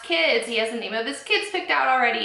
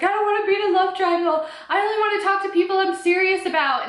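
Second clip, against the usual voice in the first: high, louder mock tearful whine; imitating Katie upset; 8 semitones higher, 3 dB louder than usual. Vlogger imitating Katie's tearful meltdown, high and loud. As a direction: play the overwhelmed girl, lightly hammed. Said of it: "Katie just began sobbing".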